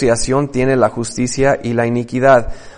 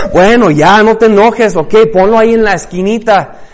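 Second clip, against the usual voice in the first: higher and louder, fed up; exasperated giving in; 9 semitones higher, 9 dB louder than usual. Retold or imitated. Solomon yields to his wife, loud and exasperated. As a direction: throw up your hands and give in loudly.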